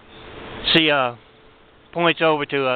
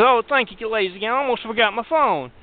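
higher, over-sweet thank-yous, hammed up; gushing thanks; 5 semitones higher than usual. Thief thanking the guards as she runs out, gushing. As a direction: lay the gratitude on thick, pitched up.

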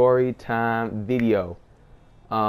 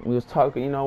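ordinary talk

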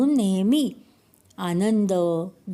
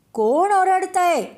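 loud startled call; 10 semitones higher, 5 dB louder than usual. A narrator's reading. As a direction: call out high and loud into the mountains. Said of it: "जोरात त्याने मोठ्या आवाजात विचारले".

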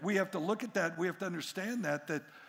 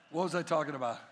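usual speaking voice

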